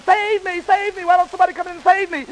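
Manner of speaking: high-pitched mock cry for rescue; yelling for help. A narrator's reading. Red chased by the wolf, yelling in a high voice. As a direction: wail it high like a hammy damsel. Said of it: "and Red is yelling".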